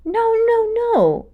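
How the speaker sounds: high, wheezy denial; wheezing. Acted. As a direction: wheeze out a weak protest. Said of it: "Malgosia wheezed".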